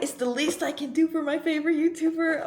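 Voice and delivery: high, loud, insistent quote; imitated eager fan. Fan insisting to favorite YouTuber, imitated high and eager.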